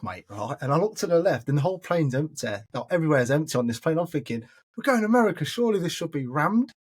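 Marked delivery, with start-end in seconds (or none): 4.8-6.7 high-pitched disbelief; puzzled inner protest, pitched up; incredulous. Retold or imitated. Passenger baffled by an empty plane, incredulous.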